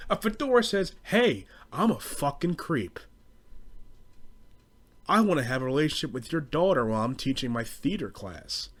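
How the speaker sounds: usual speaking voice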